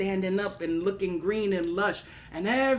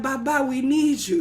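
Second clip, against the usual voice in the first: louder, higher plea for help; begging; 4 semitones higher, 6 dB louder than usual. Acted. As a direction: plead with a revered elder. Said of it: "They begged and they pleaded".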